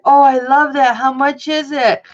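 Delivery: high, loud delighted gush; imitating delighted mom. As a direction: gush like a mom spotting a bargain. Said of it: "she'd be like".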